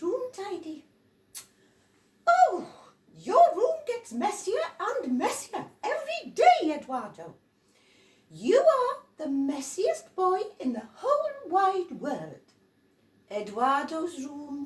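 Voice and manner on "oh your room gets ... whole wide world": loud, high scolding adult voice; scolding grown-up. Acted. Adults scolding noisy Eduardo, loud high scold.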